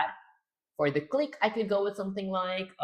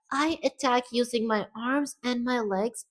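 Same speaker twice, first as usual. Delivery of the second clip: high, slow put-on female voice; feminine character voice; 6 semitones higher, slower than usual. Shown as a demonstration. Streamer's first try at her attack line, high voice. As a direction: speak slowly in a raised girlish pitch. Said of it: "Gotta get it more feminine".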